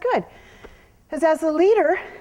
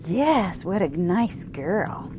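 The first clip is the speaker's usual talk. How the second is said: low, soft and slow, coaxing the mare; soothing talk to horse; 9 semitones lower, 7 dB quieter than usual. Real, not acted. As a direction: murmur gently to calm a nervous horse.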